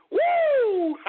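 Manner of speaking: very high, long "woo"; high, drawn-out victory whoop; celebratory whoop. Real, not acted. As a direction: let out a high whoop of triumph. Celebrates the gain.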